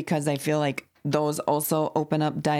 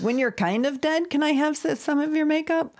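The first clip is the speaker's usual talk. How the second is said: put-on child asking; 10 semitones higher than usual. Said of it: "then she had said".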